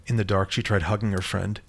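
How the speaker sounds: usual speaking voice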